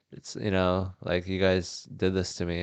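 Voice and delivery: ordinary delivery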